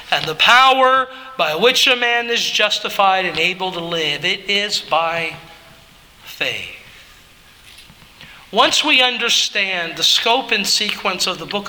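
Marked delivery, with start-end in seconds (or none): 4.2-6.7 slow, falling to a low, soft "faith"; stress on by faith. Shown as a demonstration. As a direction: let "faith" sink down softly. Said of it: "the emphasis on the last two words".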